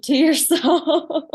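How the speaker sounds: laughing. Real, not acted. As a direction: let a laugh break through the words. Laughing while quoting parents' advice.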